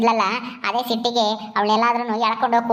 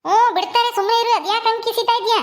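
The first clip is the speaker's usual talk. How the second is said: higher-pitched wife's voice, telling her husband to stop crying; scolding wife; 7 semitones higher than usual. Acted. Wife scolding her weeping husband at the station, higher and sharp. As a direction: chide him sharply in a higher woman's voice.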